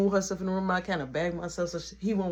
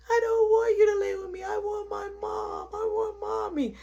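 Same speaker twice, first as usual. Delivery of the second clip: high-pitched wail for mommy; mock crying child; 3 dB louder than usual. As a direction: imitate a little boy bawling. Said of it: "Stop crying".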